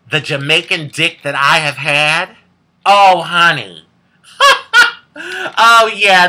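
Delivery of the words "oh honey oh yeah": laughing out the words, slow and loud. Real, not acted. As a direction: giggle through it, delighted with yourself. Laughing at own risque joke.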